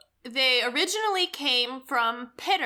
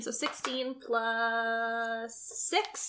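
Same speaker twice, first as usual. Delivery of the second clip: slow counting; 4 dB quieter, much slower than usual. Real, not acted.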